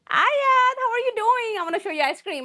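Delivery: high, sugary mock fan voice; mocking gushing fan. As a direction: tease the flirty commenter.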